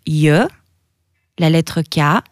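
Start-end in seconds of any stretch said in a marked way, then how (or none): 0.1-0.5 Y said as "yeu"; Y as a rising "yuh"; Albanian J sound. Shown as a demonstration. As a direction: glide y plus eu for Y. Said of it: "ça se prononce".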